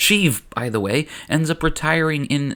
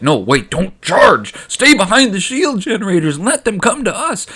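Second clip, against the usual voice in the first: higher, louder frantic mock Gungan shout; panicked Boss Nass; 7 semitones higher, 5 dB louder than usual. Gungan boss calling back charging troops, in frantic shout. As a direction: yell after them to stop. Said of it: "And Boss Nass is like".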